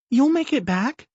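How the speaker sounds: put-on high hopeful little girl asking; a child clinging to hope; child's voice. A narrator's reading. Daughter asks her father, high hopeful child.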